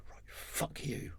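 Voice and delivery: slow, whispered, barely voiced; hushed mock whisper. Retelling the boss's furious exit line, whispered. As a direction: whisper the boss's curse slowly. Retold boss's angry reply.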